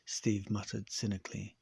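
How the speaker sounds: ordinary delivery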